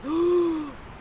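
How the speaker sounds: high, drawn-out gasp; stagey gasp. Acted. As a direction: gasp theatrically in astonishment. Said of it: "Ferdinand, you respond".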